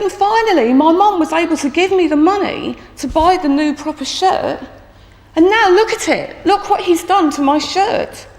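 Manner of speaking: put-on high-pitched child's aggrieved plaint; imitated crying pupil. Tearful boy shows teacher his ruined new shirt, upset. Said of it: "And he's still crying".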